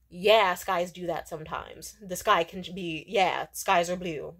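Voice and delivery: slow, loud, unimpressed reply; mock jerk. Irritated date dismissing her sky small talk, slow and condescending. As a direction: drag it out like you're humoring an idiot. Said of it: "he would just be an asshole".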